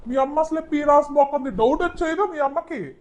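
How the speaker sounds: normal talking voice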